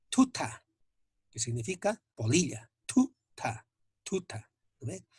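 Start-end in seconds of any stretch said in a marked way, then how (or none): 0.1-0.7 "tuta" high, with a puff after each t; aspirated T. Shown as a demonstration. Teacher models the T H example for learners, aspirated "tuta". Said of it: "la inspiración". 2.8-4.5 "tuta" broken into two puffed syllables, then whole; high "tuta", breath bursting after each t; aspirated T. Shown as a demonstration. Teacher repeats the example for correct pronunciation, aspirated "tuta". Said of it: "la inspiración".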